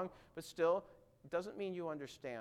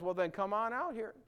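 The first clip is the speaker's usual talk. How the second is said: casual Jesus; 6 semitones higher, 3 dB louder than usual. Retold or imitated. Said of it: "I don't think he said it quite like that".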